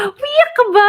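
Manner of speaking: high playful are-you-crying; high teasing question. Retold or imitated. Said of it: "Sabi niya".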